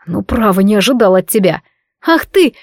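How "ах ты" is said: high, sharp cry of outrage; indignant outburst. A narrator's reading. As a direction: burst out in a flash of temper. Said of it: "в сердцах толкая его в грудь".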